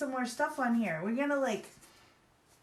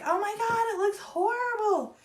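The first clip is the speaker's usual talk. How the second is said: mock panic; 12 semitones higher, 6 dB louder than usual. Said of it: "you're like".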